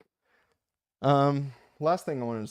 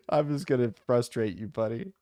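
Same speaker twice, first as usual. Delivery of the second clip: laughing; 3 semitones higher, 3 dB louder than usual. Real, not acted. Host teasing his correcting viewer, laughing as he talks. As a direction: tease while chuckling.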